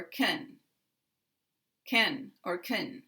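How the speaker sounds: can weakened to kin or kun; reduced can. Shown as a demonstration. Teacher giving learners both weak forms of can, kin and kun. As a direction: shrink the vowel of can to kin, then kun. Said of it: "sound like kun, or kin".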